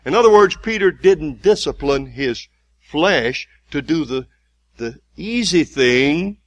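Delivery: loud, slow, hammering repetition; preacher drilling that the flesh is weak; preaching at full force. Acted. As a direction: pound the point home.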